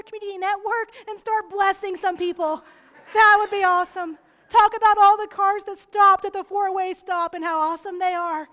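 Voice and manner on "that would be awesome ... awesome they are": much louder, fast and eager; hyped-up pitch to the congregation; excited. Real, not acted. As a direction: burst out with enthusiasm. Excited loud challenge.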